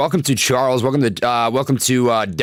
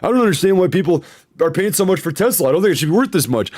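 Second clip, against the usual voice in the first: quoting Elon Musk; 4 semitones higher than usual. Retold or imitated. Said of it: "Elon Musk put out a tweet saying".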